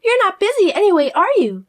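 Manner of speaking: high, loud, pushy demanding voice; bossy sister-in-law. Acted. Fiona pressing her sister-in-law to come over, high and pushy. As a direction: order her around like you own her.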